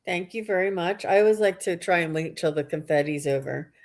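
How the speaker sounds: normal talking voice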